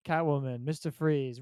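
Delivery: ordinary talk, nothing marked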